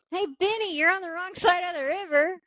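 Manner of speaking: high sing-song movie-line delivery; quoting the movie line. Retold or imitated. Friend to Benny, quoting The Mummy in a high sing-song. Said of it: "and I just go".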